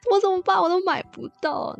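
high whimpering complaint; whiny distress. Retold or imitated. Little sister whining to brother, high and plaintive. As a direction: whine like a sulky kid. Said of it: "我就很难过".

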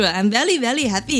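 very said as vely, happy with a rising Indian English lilt; imitating an Indian colleague's English accent. To the audience, mimicking an Indian colleague, Indian English accent. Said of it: "就大致是".

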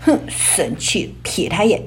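低沉缓慢的生气语气; 压着火气慢慢说; sulky anger. Acted. (低声生气地说)

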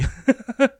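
a short high-pitched laugh; a quick high giggle. Real, not acted. Laughter trailing. (短促高声笑)

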